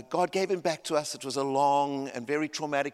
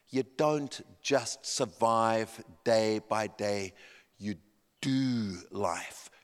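slow, weighty, lowered pitch; solemn sermon point; 5 semitones lower, much slower than usual. Real, not acted. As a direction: let each phrase land gravely. Preacher delivers the solemn point.

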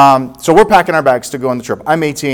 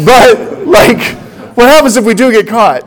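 anxious pleading; 8 semitones higher, 6 dB louder than usual. Retold or imitated. Son presses dad again, worried.

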